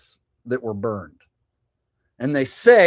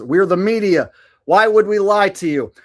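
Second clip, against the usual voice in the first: mock media voice; 5 semitones higher, 6 dB louder than usual. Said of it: "from R Y says".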